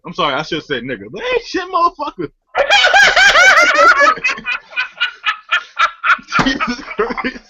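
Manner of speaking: ordinary delivery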